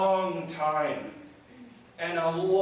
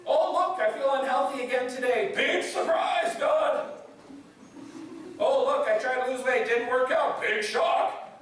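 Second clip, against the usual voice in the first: higher-pitched hammed-up bitter sarcasm; sarcastic, angry; 5 semitones higher than usual. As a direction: exclaim each letdown with mock surprise. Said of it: "It's just sarcasm and anger".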